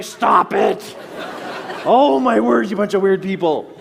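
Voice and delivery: loud laughing shout; laughing loud protest. Retold or imitated. Pushing off hugging strangers, loud laughing yell. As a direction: shout them off while laughing.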